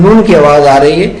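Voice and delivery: ordinary delivery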